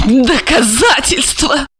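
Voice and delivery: high, drawn-out mocking echo; high slow derision; utter contempt. A narrator's reading. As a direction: spit the word back with scorn, pitched high. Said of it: "выразить всё презрение к человеческой".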